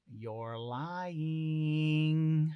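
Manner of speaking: drawn-out, rising "you're lying"; teasing accusation. Acted. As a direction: stretch it out knowingly, like a little sister calling a bluff. Scout teasingly calls out Hawking's lie.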